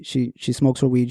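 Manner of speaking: ordinary talk, nothing marked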